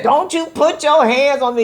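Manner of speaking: mock a hot-headed wife refusing touch; hot-tempered outburst. Wife warns off her partner's hands, acted indignant. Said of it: "hot-headed and got a temper and you say".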